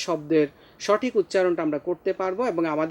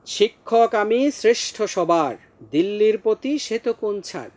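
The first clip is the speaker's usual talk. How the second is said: raised, deliberate verse declamation; poem recitation; 4 semitones higher than usual. Acted. Teacher voices the poem's proud schoolmaster, high declamation. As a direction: declaim it high and firm, in rhythm.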